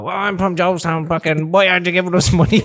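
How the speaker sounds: high, mocking rough-lad voice; mock rough lad from Jobestown, pitched high; mock rough local lad. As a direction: ham up a local lad asking for money. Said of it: "he's just gonna go".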